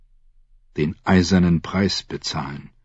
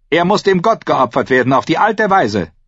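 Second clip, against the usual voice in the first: high, raised priestly call; loud proclamation; 10 semitones higher, 4 dB louder than usual. A narrator's reading. Priest demands a sacrifice to his god, calling out. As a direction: proclaim the rite with force. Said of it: "rief Aeron Feuchthaar".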